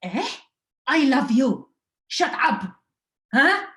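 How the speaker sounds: hammed-up mother shrieking in mock outrage; mom yelling back. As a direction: shout it like an offended mother. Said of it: "She goes".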